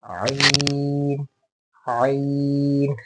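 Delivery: very long आइन from a squeezed throat; throat onset. Shown as a demonstration. Teacher modelling the key sound, long constricted आइन. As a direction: squeeze the start, then stretch आइन slowly. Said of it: "ये निकलना ज़्यादा ज़रूरी है".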